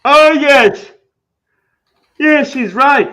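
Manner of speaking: high, loud burst of agreement; excited agreement. Real, not acted. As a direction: jump in, thrilled he got it right. Agreeing loudly about aluminium.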